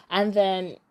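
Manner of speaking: normal talking voice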